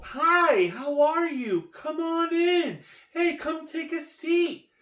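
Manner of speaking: very high, loud, drawn-out greeting; welcoming stranger's voice. Acted. Board member inviting a passerby into the meeting, high hammed-up welcome. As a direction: call out a slow, high-pitched hammed-up welcome. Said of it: "I hear a voice go".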